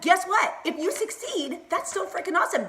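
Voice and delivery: high-pitched, thrilled pep talk; excited cheering on. Real, not acted. Cheering viewers on to succeed.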